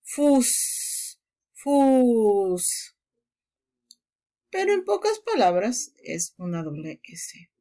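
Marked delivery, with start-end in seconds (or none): 0.0-3.0 fus with a long u and a sharp double s; German pronunciation. Shown as a demonstration. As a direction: say fus slowly, long vowel then a hissed ss. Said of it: "Por ejemplo, acá dices".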